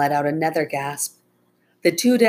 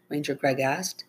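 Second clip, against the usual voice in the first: plain reading voice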